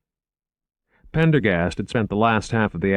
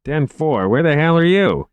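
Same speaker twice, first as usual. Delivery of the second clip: louder, higher radio retort; irritated demand; 9 semitones higher, 6 dB louder than usual. Acted. Dispatcher asking lost guard where he is, irritated demand. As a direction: demand an answer over the radio.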